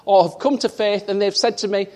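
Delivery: fervent pulpit delivery, full of conviction; preaching. Acted. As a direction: preach it out to the congregation. Preacher building to climax.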